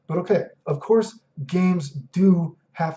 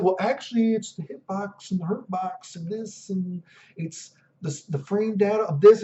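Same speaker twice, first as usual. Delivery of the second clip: know-it-all nerd; 5 semitones higher than usual. Retold or imitated. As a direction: play a smug nitpicker correcting everyone. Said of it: "It's like".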